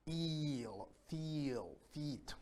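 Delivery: soft, drawn-out ee in Eel, Feel, Feet; long E sound. Shown as a demonstration. Teacher showing the long vowel in Feel, long E sound. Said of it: "It's the long E sound".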